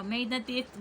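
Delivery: ordinary talk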